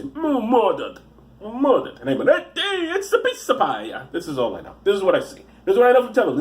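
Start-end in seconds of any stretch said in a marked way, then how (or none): 0.0-2.0 high, hammed-up TV-detective impression; mock accusation in a put-on voice; Quincy. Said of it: "That was Quincy". 2.3-3.8 high, hammy mock Italian accent; shout-sing it as cartoon Italian; silly Italian-American accent caricature.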